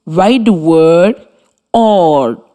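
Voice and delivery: slow, careful dictation. Shown as a demonstration. Radio teacher dictating a word to pupils, slowly. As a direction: spell it out slowly for pupils copying. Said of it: "dictating a spelling word".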